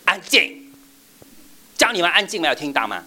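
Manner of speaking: high, loud angry shout; mock furious shout for silence; yelling teacher. Shown as a demonstration. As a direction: scold the noisy class. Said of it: "我们不会说".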